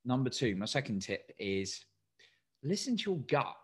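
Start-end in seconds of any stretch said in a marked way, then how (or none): none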